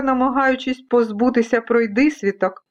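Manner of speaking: ordinary delivery, nothing marked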